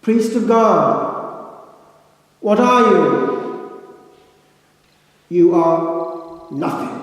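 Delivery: slow, loud, drawn-out pronouncement; solemn proclamation. Retold or imitated. Abbot's sermon line to new priests, slow loud proclamation. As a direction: boom it out word by word like a pulpit verdict. Quoting Saint Norbert in sermon.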